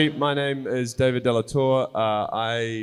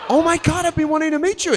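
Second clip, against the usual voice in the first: much higher, louder, gushing; excited gush; 5 dB louder, faster than usual. Retold or imitated. [name] retelling his reply to Claire, excited gushing. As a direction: gush like a starstruck fan. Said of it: "I was like".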